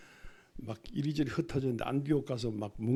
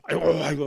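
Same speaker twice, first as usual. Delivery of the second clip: loud keening 아이고, 아이고; louder wailing; 3 semitones higher, 10 dB louder, much faster than usual. Shown as a demonstration. Pastor showing the congregation how mourners cry, loud wailing. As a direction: wail 아이고 twice in mourning. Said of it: "아이곡이 다르고 의곡이 달라요".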